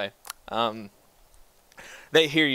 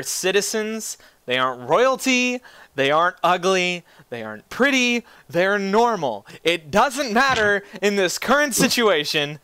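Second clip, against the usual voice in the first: rising, exasperated insistence; 6 semitones higher than usual. Real, not acted. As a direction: lay it out, getting worked up.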